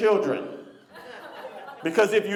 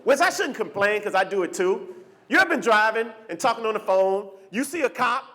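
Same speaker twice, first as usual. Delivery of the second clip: usual speaking voice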